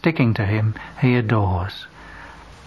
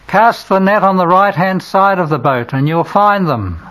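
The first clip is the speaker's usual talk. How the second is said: much higher, louder call from afar; 7 semitones higher, 5 dB louder than usual. Acted. Man on shore calls instructions to fishermen, loud. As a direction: call it across the water with authority.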